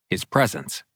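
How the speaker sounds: usual speaking voice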